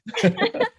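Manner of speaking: short, high friendly chuckle; light laugh. Real, not acted. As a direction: laugh it off warmly. Laughing at the name mix-up.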